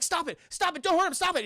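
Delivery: high, urgent acted pleas; frantic pleading. Catalina begs attacker to let him go, mock frantic pleading. Acting woman pleading to stop choking.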